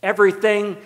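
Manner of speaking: ordinary talk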